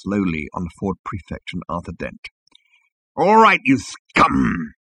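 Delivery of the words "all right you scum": slow, drawn-out growled threat; slow snarling interrogator; menacing growl. A narrator's reading. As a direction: growl it, stretching the insult. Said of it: "he growled".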